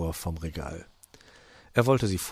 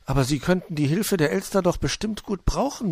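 higher, gently emphatic character voice; Puh, persuading; 10 semitones higher, 4 dB louder than usual. A narrator's reading. Puh urging fox to accept help, high-voiced persuasion. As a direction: reason with him in a pitched-up voice.

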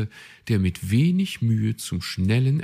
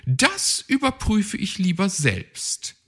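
much higher, bossy know-it-all bird; chirpy, self-assured magpie; 13 semitones higher, 5 dB louder than usual. A narrator's reading. Magpie explains her plan, high bossy voice.

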